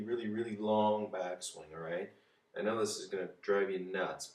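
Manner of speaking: ordinary delivery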